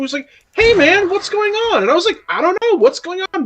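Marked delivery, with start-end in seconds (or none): 0.5-1.8 high, friendly greeting in a retold voice; imitating a super nice guy, pitched up; super cordial. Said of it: "super nice and super cordial". 2.3-3.4 high, friendly retold reply; imitating a very nice chat, pitched up; super polite. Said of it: "very nice, super polite".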